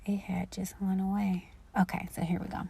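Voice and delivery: unvoiced mutter under her breath; whispered aside. Real, not acted. Her page vanished, whispering to herself.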